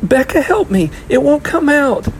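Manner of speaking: high, loud plea for help; frustrated little girl wailing to her sister. A narrator's reading. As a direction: beg in a high, distressed child's voice. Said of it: "she wailed".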